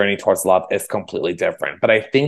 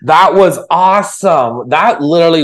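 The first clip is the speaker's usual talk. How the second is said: high, loud delighted praise; loud, high and thrilled, slowing on the repeat; excited; 8 semitones higher, 5 dB louder than usual. Real, not acted. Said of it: "just like Steve Irwin".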